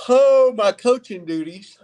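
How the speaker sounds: words caught mid-burp, high and loud; a slip in the voice, then excuse me; voice catching. Real, not acted. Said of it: "excuse me".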